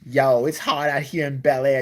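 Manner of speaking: slow, loud mock-rapper swagger; wannabe gangster rapper. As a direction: parody a rich kid acting street. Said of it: "gonna be a gangster rapper".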